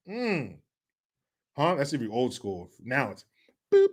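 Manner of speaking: ordinary talk, nothing marked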